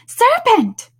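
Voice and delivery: high, loud pigeon screech; screamed. A narrator's reading. Pigeon attacking Alice as a serpent, screamed. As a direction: scream it out in shrill accusation. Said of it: "screamed the pigeon".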